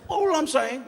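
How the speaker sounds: high, earnest mock boss; high-pitched fussy manager imitation; manager's voice. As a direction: squeak it out like a prim manager. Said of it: "he says like that".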